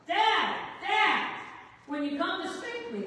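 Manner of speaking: high, loud yell for Dad; imitated child's scream. Boy calls his dad despite the spanking threat, imitated scream. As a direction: shout like a boy calling through the house, acted. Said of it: "the boy screams".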